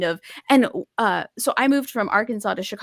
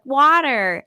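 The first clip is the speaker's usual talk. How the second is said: WATER with an American flapped T, loud and higher; American-accented child; 5 semitones higher, 6 dB louder than usual. Retold or imitated. Three-year-old answers her British mother, in an American accent. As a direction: imitate a little kid answering in an American accent. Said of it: "has an American accent".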